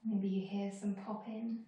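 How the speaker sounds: calm body-scan guidance with long pauses; guided meditation. Acted. Teacher leading students through a moving body scan, calm meditation voice. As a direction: guide them unhurriedly from one body part to the next.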